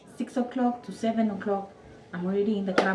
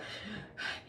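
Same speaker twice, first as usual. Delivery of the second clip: exhausted sigh; 16 dB quieter than usual. Retold or imitated. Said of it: "out of breath".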